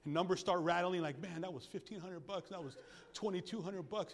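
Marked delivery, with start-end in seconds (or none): 1.2-4.1 much softer worried muttering; quiet mock grumbling about money; muttered worry. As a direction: grumble the costs under your breath. Congregation counting roof costs.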